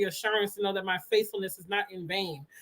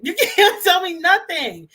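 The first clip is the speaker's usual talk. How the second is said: higher, louder, laughing through it; laughing with joy; 8 semitones higher, 6 dB louder, slower than usual. Real, not acted. Repeating her boast to viewers, higher and laughing. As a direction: burst out with giddy laughter. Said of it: "overjoyed after Sunday service".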